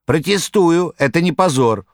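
high loud cat yell. Acted. The cat yelling in Margarita's defence, loud. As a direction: bellow your protest. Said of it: "орал кот".

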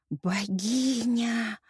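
slow, drawn-out awe; rapt admiration. A narrator's reading. Hero adoring the woman before him, rapt and drawn-out. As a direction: breathe out the word in long, held wonder. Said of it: "восхищенно произнёс он".

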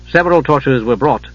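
ordinary delivery

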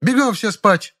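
very high, commanding dismissal; imperious order. A narrator's reading. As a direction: scatter them with one order. Elder actress stops brawl. (властный приказ)

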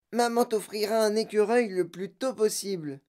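high-pitched motherly voice; placating mother. A narrator's reading. Mother promising her daughter a squirrel, placating.

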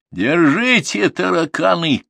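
high, loud shout; battle yell. A narrator's reading. Grandson throws firecrackers at the raiders, yelling. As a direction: yell it as you hurl the charge. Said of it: "крикнул Артур".

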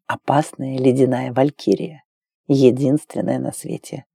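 low, soft declaration of love; tender male lover. A narrator's reading. Lover reassures Claire she is the one, low tender voice. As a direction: drop low and quiet, reassure her.

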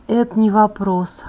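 usual speaking voice

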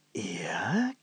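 hushed whispered yes. A narrator's reading. Mayor whispering over the keyhole, hushed. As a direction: breathe it out in a whisper.

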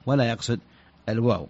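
ordinary talk, nothing marked

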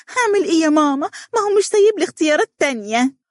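mock her helpless daughter's whine; mimicking the daughter. Mother mocking Donia's future complaint, high and whiny. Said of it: "تقولي لي".

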